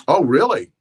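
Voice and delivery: loud, high "oh really"; surprised. Retold or imitated. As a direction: act caught off guard. Retold surprised reaction to bat claim.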